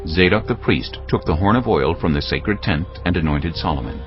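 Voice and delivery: ordinary talk, nothing marked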